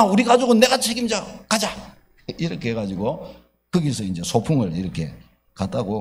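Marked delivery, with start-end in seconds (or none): 0.0-1.7 much higher and louder, stress on 내가; proud shout. Retold or imitated. Husband boasting he'll take responsibility. (가족을 책임진다고 큰소리치는 남편, "내가"를 높고 힘주어)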